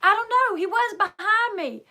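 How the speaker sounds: put-on high, loud voice of a frightened child; scared little boy. Young son tells his mother he lost his brother, frightened. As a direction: play the boy blurting it out, scared. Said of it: "he goes".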